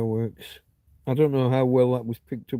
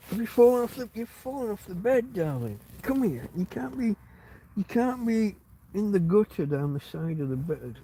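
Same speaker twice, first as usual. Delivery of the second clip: higher, cooing concern; coaxing pet voice; 7 semitones higher than usual. Real, not acted. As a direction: fuss over the dog in a high voice. Dog fell off bed, coaxing.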